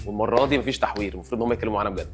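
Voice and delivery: ordinary talk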